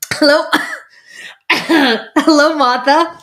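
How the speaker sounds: loud, high mock Long Island greeting; Long Island accent. Host imitating a friend from Long Island, exaggerated accent. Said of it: "clearly a Long Island accent".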